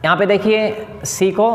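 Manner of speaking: ordinary talk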